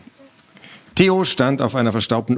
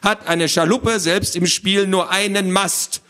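high, lecturing little creature; squawky know-it-all; 8 semitones higher than usual. Acted. Little man explains gnomes to children, squawky lecturing. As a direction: lecture them in a fussy squawk. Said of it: "eine quäkende Stimme".

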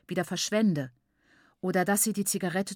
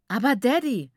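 higher, louder objection; protesting; 5 semitones higher, 7 dB louder than usual. A narrator's reading. Daughter contradicts her father at dinner, protesting. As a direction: burst out in protest. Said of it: "widersprach Nyasha unvorsichtigerweise".

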